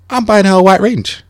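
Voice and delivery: ordinary talk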